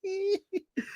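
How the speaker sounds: high laugh. Real, not acted. Streamer laughing at the clip.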